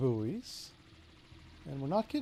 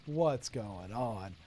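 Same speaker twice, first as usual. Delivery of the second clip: "What's going on?" drawn out very slowly, lower and softer; mock bewilderment; 7 semitones lower, 8 dB quieter, much slower than usual. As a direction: play the stunned voter in slow disbelief. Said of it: "I was like".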